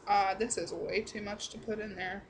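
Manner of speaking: ordinary delivery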